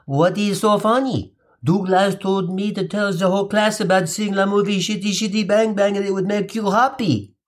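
put-on a baffled French kid asking what's funny; confused French boy. French boy confused that the class is laughing. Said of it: "a confused Pierre said".

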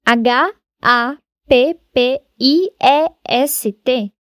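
letters spelled slowly one by one; slow spelling. Shown as a demonstration. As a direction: spell it out deliberately. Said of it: "Agora, happiest". (soletrando devagar)

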